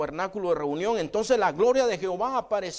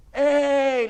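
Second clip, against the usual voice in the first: calling out; 9 semitones higher, 7 dB louder than usual. Retold or imitated. Prophet calling out to a ruler.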